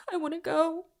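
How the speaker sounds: high, tearful and trembling; barely holding back sobs; quavering, wet voice. Acted. Heather begging to leave after the curse, quavering and tearful. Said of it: "in a quavering, wet voice".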